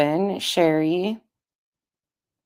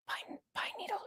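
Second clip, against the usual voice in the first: breathy whisper, much softer; 17 dB quieter than usual. Real, not acted. Streamer greeting a viewer so the kids stay asleep, whispering. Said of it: "we're whispering too".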